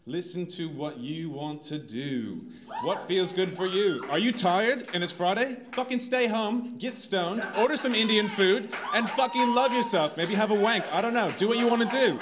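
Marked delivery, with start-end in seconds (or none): 2.8-12.2 excited urging. Real, not acted. Urging audience to self-love.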